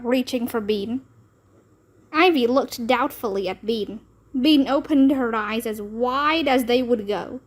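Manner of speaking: ordinary delivery, nothing marked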